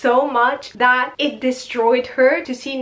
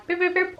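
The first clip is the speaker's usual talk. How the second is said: mock beeping; 7 semitones higher, faster than usual. Antennas picking up gossip.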